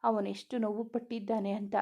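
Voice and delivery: ordinary delivery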